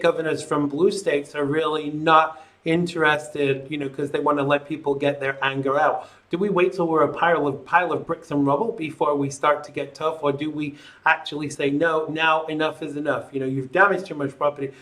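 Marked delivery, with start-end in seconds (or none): none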